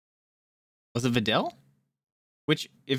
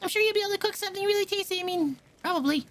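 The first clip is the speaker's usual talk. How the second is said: put-on high, fussy young cook voice; high-pitched cook girl; faster than usual.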